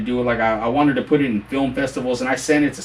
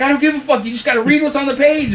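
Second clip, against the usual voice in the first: very high, exasperated imitation; arguing back; 13 semitones higher, 4 dB louder than usual. Director to stubborn narrator, acted exasperated order. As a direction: fire back in a mock studio row. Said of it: "we're going back and forth".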